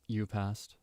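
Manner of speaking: soft, unsettling praise from a teacher; hushed creepy teacher line. Acted. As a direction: say it softly, a bit too intimate. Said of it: "Kinda scary".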